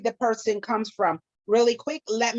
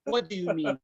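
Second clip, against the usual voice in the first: Nigerian accent; 4 semitones lower, 5 dB quieter than usual. Shown as a demonstration. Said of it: "like, you're upset".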